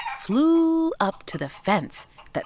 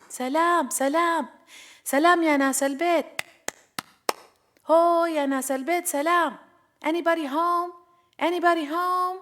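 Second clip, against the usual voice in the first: mild calling out; 9 semitones higher than usual. A narrator's reading. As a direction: call out to see who's home, pitched high. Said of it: "she clapped and she yelled out".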